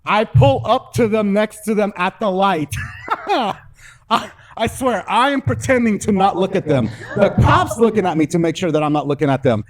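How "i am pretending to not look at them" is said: ordinary delivery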